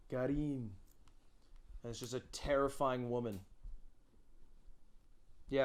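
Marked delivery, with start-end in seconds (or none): 0.1-0.7 softer "Karin" in a mock Japanese accent. Shown as a demonstration. Streamer repeating the joke name, put-on Japanese accent. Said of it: "Just Karen with Japanese accent".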